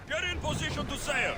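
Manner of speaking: high, carrying command to the crew; calling out orders. Acted. Captain calling the crew to sail. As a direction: call it across the deck.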